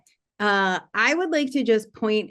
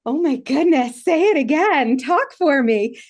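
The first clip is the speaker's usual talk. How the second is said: put-on higher, louder swooning over an accent; gushing delight; 6 semitones higher, 5 dB louder than usual. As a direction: gush with raised pitch and volume, begging to hear more. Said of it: "I'm like".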